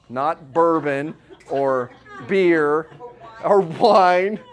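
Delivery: slow and drawn out, then loud on wine; joking aside. Real, not acted. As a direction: tease the crowd with a slow joke. A joking correction about water.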